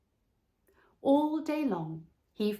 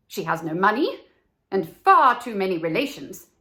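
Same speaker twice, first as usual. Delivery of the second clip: gossiping birds, louder toward the end; twittering swallows; 8 dB louder than usual. Acted. Other swallows mocking the reed, louder gossip. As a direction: sneer at her lack of money. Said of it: "twittered the other swallows".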